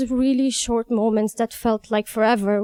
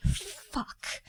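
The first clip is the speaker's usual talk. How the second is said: much softer, under-the-breath curse; hushed swear; 4 semitones higher, 14 dB quieter than usual. Retold or imitated. Woman realizes an ambulance is being called, hushed swear. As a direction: let the dread out in a tiny hushed swear.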